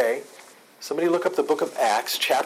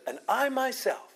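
"I" punched high in "And I myself"; emphatic I; 9 semitones higher than usual. Shown as a demonstration. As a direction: lean hard on the word "I", pitched well up. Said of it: "the I is emphatic".

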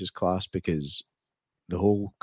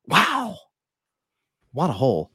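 impressed wow; 4 semitones higher, slower than usual. Retold or imitated. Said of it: "He's like".

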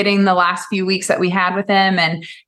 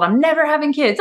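higher-pitched imitation of a stubborn kid; mock little-kid declaration, pitched up; eight-year-old self; 7 semitones higher than usual. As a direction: play your childhood self digging in. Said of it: "I'm, like, eight years old".

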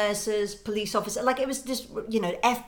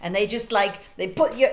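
usual speaking voice

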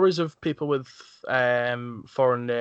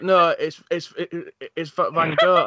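higher, stumbling insistence; course mate insisting; 4 semitones higher, 5 dB louder than usual. Retold or imitated. Girl on the course insisting back, imitated high. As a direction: push back high, with false starts. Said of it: "And she went".

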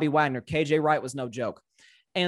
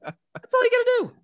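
gleeful squeal; 6 dB louder than usual. Real, not acted. Teasing friend about the bet.

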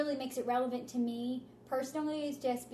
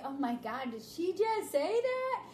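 slow, pitched-up gasping disbelief; shocked audience; 4 semitones higher, slower than usual. Retold or imitated. Shocked audience member reacting, slow and higher. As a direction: play a scandalized listener, drawn out. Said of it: "making them say".

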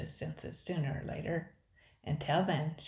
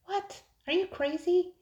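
pitched way up, louder, incredulous; shocked disbelief; 6 dB louder than usual. A narrator's reading. A frightened brother balking at his sister's plan, pitched way up in shock. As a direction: squeak out your disbelief.

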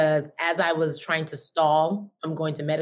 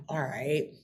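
resigned self-talk; 4 semitones lower, 8 dB quieter than usual. Retold or imitated. Talking herself into keeping going.

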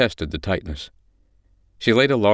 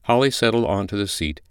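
usual speaking voice

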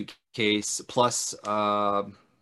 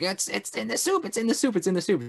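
high-pitched, panicky repeated warning; mock panic, pitched way up; frantic warning; 9 semitones higher, faster than usual. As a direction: imitate someone frantically trying to warn everyone. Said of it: "trying to warn everybody".

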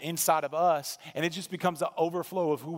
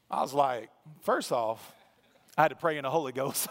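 ordinary talk, nothing marked

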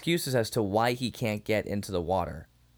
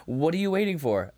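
ordinary delivery

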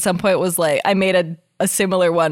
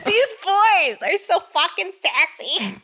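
story narration; 5 semitones higher than usual. Acted. Reads fanfic aloud.

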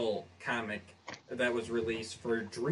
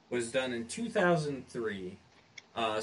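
normal talking voice

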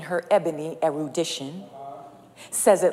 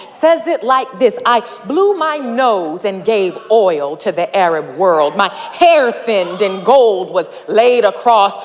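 loud, higher preaching with rising fervor; full-force preaching; 5 semitones higher, 9 dB louder than usual. Acted. Preacher driving the point home to the congregation, loud and rising. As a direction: whoop it up in the pulpit.

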